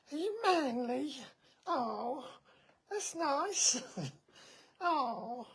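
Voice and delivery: very high cooing through laughter. Real, not acted. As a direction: coo up high while cracking up. Cooing while laughing at pet.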